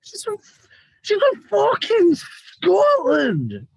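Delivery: high screeched Scottish wrestler, voice near breaking; Kaylee Ray impression. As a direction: drag out the words in a high, cracking Scottish screech. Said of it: "Trying to make my voice like break".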